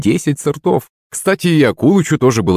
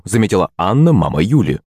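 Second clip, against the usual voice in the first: ordinary delivery, nothing marked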